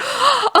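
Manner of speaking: high startled intake of breath; sudden alarmed gasp; sharp gasp. Retold or imitated. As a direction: gasp as it sinks in. Realizes she must go.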